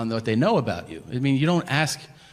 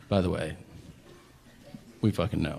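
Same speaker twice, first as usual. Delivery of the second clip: condescending; 5 semitones lower than usual. Retold or imitated. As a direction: confide it coolly, putting an intruder in their place. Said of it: "give you the look like".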